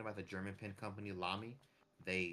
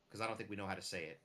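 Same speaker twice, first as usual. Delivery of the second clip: normal talking voice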